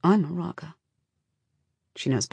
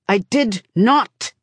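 loud, slow, emphatic denial; angry denial; 5 semitones higher, 4 dB louder, slower than usual. A narrator's reading. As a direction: bite out each word. Father snaps denial in quarrel.

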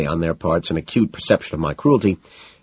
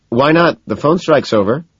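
higher, loud, light quip; half-joking; 9 semitones higher, 6 dB louder than usual. A narrator's reading. Narrator tries humour on angry Evelyn, half-joking. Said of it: "I joke. Sort of".

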